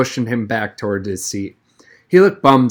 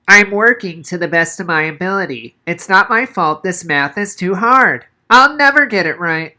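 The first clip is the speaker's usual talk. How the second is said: put-on a wimpy boy pleading with a quivering lip; whiny Noah; 7 semitones higher, 5 dB louder than usual. A narrator's reading. Noah whining to the teacher about the hard math. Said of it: "His lower lip quivered".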